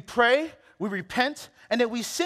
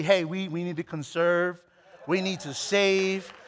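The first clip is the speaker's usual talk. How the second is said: wife's voice; 5 semitones lower, 4 dB quieter than usual. Retold or imitated. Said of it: "she's very".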